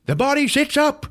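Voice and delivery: high, startled narration jump; sudden scare. A narrator's reading. Storyteller springing the dream scare, sudden high exclamation. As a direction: pitch leaping up for the jolt.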